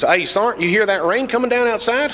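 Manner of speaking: high, eager hillbilly call; hillbilly soldier. Retold or imitated. Hillbilly soldier hollering to his sergeant about the rain, high and eager. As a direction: pitch it up like a good old boy hollering to his sergeant.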